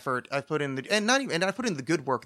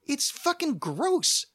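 usual speaking voice

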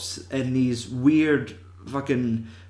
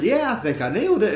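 mocking actress voice; 7 semitones higher, faster than usual.

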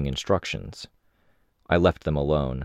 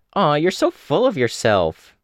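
much higher, louder mock coo; teasing Imp; 11 semitones higher, 5 dB louder than usual. Imp mocks Taylor, much higher cooing tease. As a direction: tease her like a cheeky teen.

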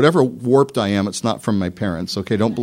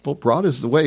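ordinary talk, nothing marked

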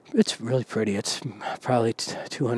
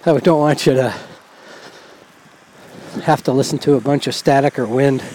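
raised voice; 3 semitones higher, 5 dB louder than usual. Real, not acted. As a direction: speak up to be heard over wind and traffic. Talking over traffic and wind.

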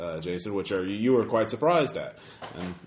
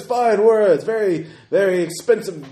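booming, higher, slow accusing roar; booming arrogant alien overlord; 12 semitones higher, 9 dB louder, slower than usual. Acted. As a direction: denounce the liar at full roar. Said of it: "that same booming voice".

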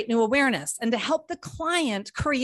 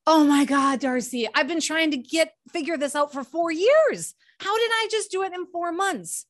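high-pitched astonishment, rising through the quote; amazed client; 6 semitones higher than usual. Retold or imitated. Coach quoting clients after four months of coaching, amazed. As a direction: imitate a client amazed at the quick result. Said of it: "I have people all the time, Victoria, say".

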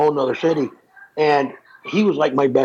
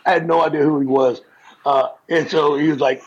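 normal talking voice

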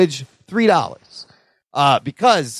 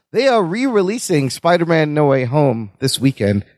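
ordinary talk

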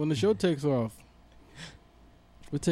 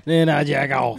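imitating T I; 7 dB louder than usual. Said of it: "like T I said".

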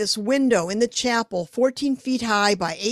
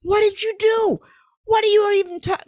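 much higher, louder panicked self-questioning; panicked inner voice; 9 semitones higher, 4 dB louder than usual. Retold or imitated. Artist panicking after promising the window, high and loud. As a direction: rattle off your own doubts in alarm. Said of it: "And I thought".

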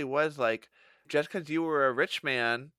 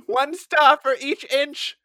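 high, loud, slow rating; critic voice; 11 semitones higher, 10 dB louder, slower than usual. Retold or imitated. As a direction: announce it grandly.

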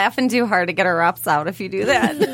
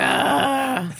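high, drawn-out straining groan; mock a weightlifter's effort noise; imitated lifter's grunt; 8 semitones higher than usual. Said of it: "And they're like".

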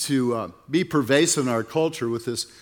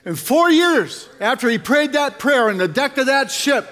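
raised, intoned pastor's prayer; fervent prayer; 7 semitones higher, 3 dB louder than usual. Real, not acted. Pastor praying over the congregation's children, fervent prayer. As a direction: lift the room up in prayer.

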